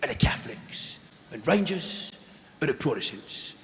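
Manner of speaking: mock Cockney documentary presenter, halting and dramatic; put-on London hard man, slowing at the end; Danny Dyer. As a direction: present to camera in short, weighty phrases. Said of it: "he's talking to the camera".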